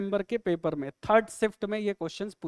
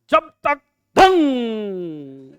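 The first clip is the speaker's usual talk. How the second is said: loud mock sound effect, last note held long; vocal punchline sting, slow and booming; mimicked drum sting; 7 dB louder, much slower than usual. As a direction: belt out a drawn-out ringing "dhang". Mimics comic sound effect after jokes.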